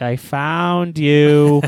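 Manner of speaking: very slow, calm and deadpan; drawn-out, calmly menacing. Retold or imitated. Saitama pops up at mole.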